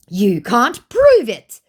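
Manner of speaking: loud, high, drawn-out challenge; exaggerated quoted dare; mock scammer. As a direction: act out the scammer's defiance, slowly and loudly. Acting out scammer's defiant text.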